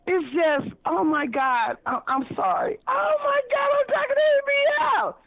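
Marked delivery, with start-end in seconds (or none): 2.9-5.2 much higher, squealing with excitement; starstruck excitement. Real, not acted. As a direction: gush like a fan meeting her idol. Starstruck at meeting A B L.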